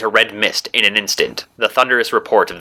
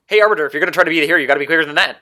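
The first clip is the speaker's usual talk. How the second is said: very fast, higher-pitched speedster voice; rapid lilt; 8 semitones higher, much faster than usual. A narrator's reading. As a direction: rattle it off in a high, lilting rush. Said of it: "the rapid voice of the Emerald Dash lilted".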